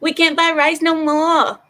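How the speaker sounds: put-on high, loud caricature Chinese accent; mock Chinese accent. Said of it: "I can't do the Chinese accent".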